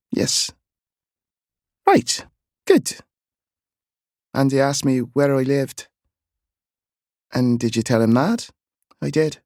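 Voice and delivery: quick worried back-and-forth; boy and aunt dialogue. A narrator's reading.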